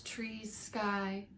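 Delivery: ordinary delivery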